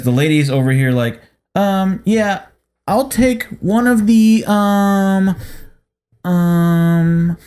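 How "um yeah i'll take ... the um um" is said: higher and slow, stretched hesitant order; mock dithering customer. Mimicking the slow lady ahead in line, higher and slow.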